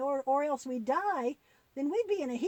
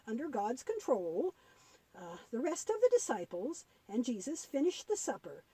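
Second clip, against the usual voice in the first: ordinary delivery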